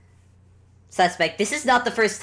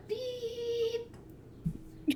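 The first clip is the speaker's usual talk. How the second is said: very high, soft electronic beep; long quiet squeaked beep; high thin beep; 11 dB quieter than usual. Acted. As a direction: imitate a machine switching off. Imitates announcer switching device off.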